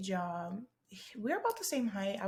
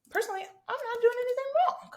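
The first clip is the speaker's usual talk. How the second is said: very high, louder, put-on innocence; high-pitched protest; 5 dB louder than usual. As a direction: protest way up in a squeaky register. Playful self-defence, then repeated.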